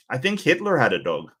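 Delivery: normal talking voice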